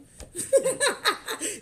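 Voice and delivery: loud high burst of laughter. Real, not acted.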